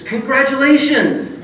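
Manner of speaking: high, loud, slow, beaming; hearty congratulations. Retold or imitated. Ron greeting him with a big grin, exclaiming. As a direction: boom it out cheerfully.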